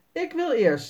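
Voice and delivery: put-on a boy claiming the first turn; eager boy, Jasper. A narrator's reading. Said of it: "zegt Jasper".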